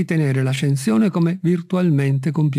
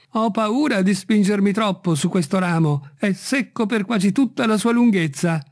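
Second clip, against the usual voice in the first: high-pitched, lightly fearful servant; worried Jupiter; 6 semitones higher than usual. A narrator's reading. Servant afraid to go further along the branch, worried. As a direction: balk nervously in a raised voice.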